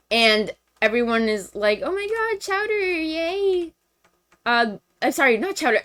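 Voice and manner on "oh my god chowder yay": mock cheering. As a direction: imitate a delighted little crowd. Voicing everyone cheering the rescue.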